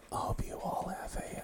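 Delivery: soft playful ASMR whisper; giggly prank whisper. Pretend. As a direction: whisper close to the mic, giggling. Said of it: "let's whisper into the mic".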